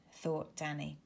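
ordinary delivery

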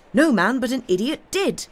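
high, emphatic revelation; agitated curate. A narrator's reading. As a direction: drive the point home excitedly. Agitated curate makes his revelation.

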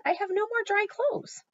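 very high, loud protest; indignant protest. A narrator's reading. Amelia Bedelia refusing Mister Rogers, indignant. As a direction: protest stubbornly at a high pitch. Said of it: "Amelia Bedelia stamped her foot".

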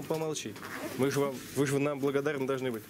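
ordinary delivery

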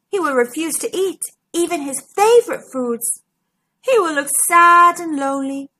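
usual speaking voice

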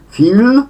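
loud, high feel with a drawn-out ee; long i. Shown as a demonstration. Teacher corrects a slip, long-vowelled feel. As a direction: stretch the ee in feel, rising strongly. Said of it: "wydłużone I".

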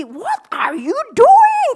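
very high, loud, slow shout; mock yell. Coworker yells at her mistake, high loud slow shout. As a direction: shout it in disbelief.

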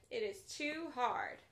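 ordinary delivery, nothing marked

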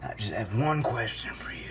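low, soft whisper; hushed. Acted. Host confronting the caller, hushed low whisper. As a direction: drop to a grave near-whisper. Set-up before the wail.

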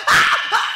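loud breathy ah cries; dying scream. Retold or imitated. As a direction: scream as she slips away. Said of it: "she was screaming".

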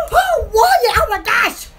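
high, loud gasp of delighted surprise; mock amazement. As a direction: act thrilled and astonished, voice leaping up. Acted reaction to surprise destination.

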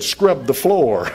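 loud, drawn-out retold command; drill instructor order. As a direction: bellow it slowly like a drill instructor.

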